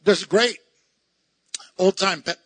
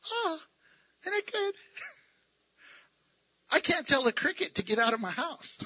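ordinary talk, nothing marked

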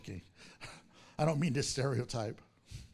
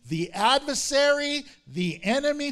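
louder, higher accusing cry; queen Esther naming her enemy before the king, raised voice; yelling; 8 semitones higher, 8 dB louder than usual. Retold or imitated. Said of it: "She yells it, exclamation point".

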